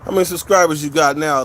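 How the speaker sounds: ordinary delivery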